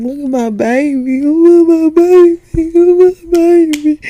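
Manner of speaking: put-on high, loud cooing over her baby; doting mom. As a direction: squeal like a mother gushing over her child. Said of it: "And I was like".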